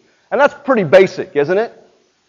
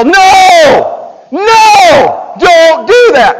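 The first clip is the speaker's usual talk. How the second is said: high, loud panicked parent shout; yelling no; 10 semitones higher, 9 dB louder, much slower than usual. Retold or imitated. Parent shouting at toddler near outlet, loud yell. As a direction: yell it like you caught them at the outlet. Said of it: "You yell".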